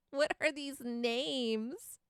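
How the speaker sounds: slow, half-crying with laughter; What are these names asked slowly through laughter; laughing disbelief. Real, not acted. Reacting to viewers' funny names.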